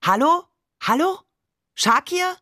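high, rising calls down the phone; calling out. A narrator's reading. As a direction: call out questioningly into a dead line. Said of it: "Barbe rief".

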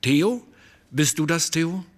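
high, loud call toward the door. A narrator's reading. Painter calls to Theo at the studio door, loud and high. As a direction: call out Theo's name across the room. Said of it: "Dann rief er".

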